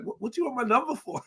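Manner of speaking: high, coy question. Retold or imitated. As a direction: tease it out like a flattered granny.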